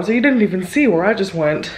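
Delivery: ordinary talk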